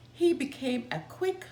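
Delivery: ordinary delivery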